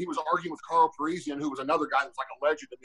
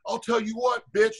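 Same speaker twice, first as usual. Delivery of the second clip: higher-pitched retold taunt; mock the tough guy's opening threat; raised-voice impression of a heckler; imitating a trash-talker; 6 semitones higher than usual. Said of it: "first thing I heard is".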